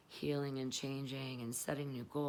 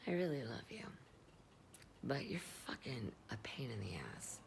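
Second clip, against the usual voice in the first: soft whispered confession; whispered love and jab; 5 dB quieter, faster than usual. Real, not acted. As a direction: whisper it to him fondly, then tease. Direct address to ex, whispered.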